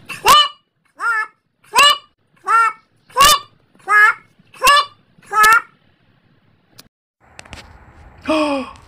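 loud hoof sounds, spaced out; mock hoofbeats; heavy clip-clop. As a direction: stomp out each hoofbeat. Big goat hoof sounds.

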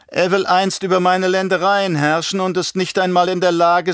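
high, haughty baron voice; belehrender Baron. Acted. Baron lectures his nephew. (hohe Baronstimme, herablassend belehrend)

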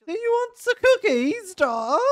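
very high, loud, slow sugary lady; creepy sweet voice. Pretend. Gamer voicing a creepy cookie lady, eerie high coo. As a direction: coo it too sweetly to unsettle. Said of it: "That voice is very unsettling".

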